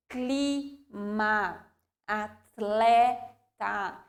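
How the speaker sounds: "clima", "atleta" slowly, clusters held together; slow careful words. Shown as a demonstration. Teacher contrasting the same-syllable clusters. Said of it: "a gente tem que observar aqui".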